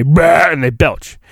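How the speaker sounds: ordinary talk, nothing marked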